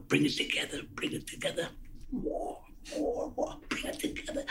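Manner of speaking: hushed whisper coaxing the actor; whispered direction. Retold or imitated. Director whispering to his actor mid-scene, hushed. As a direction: whisper it from behind the camera.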